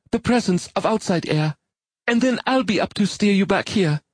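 high, brisk female character voice; woman's voice. Acted. Molly explains the emergency device.

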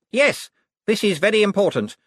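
high, solemn pompous voice; pompous lord. A narrator's reading. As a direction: announce it with lordly weight. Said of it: "some inner Cosmo".

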